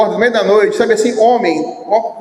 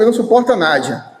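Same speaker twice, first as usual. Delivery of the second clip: ordinary talk, nothing marked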